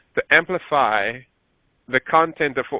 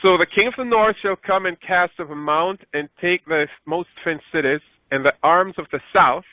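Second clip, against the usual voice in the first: raised, measured scripture reading; solemn Bible reading voice; 4 semitones higher than usual. Acted. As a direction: read the verse out like Scripture. Rereads with amplified understanding.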